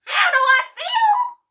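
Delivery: very high, loud yell of a tiny, furious grandma. Acted. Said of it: "she yelled".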